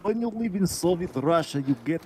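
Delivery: hammed-up Russian accent; mock Russian accent. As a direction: joke Soviet-Russia line in a put-on Russian accent. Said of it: "who wants to talk like Russian".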